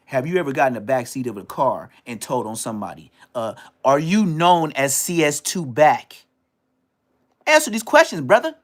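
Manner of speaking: rising to a shouted demand; building interrogation shout. Pretend. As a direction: press harder each question. Escalating lie detector grilling.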